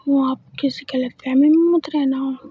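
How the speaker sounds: usual speaking voice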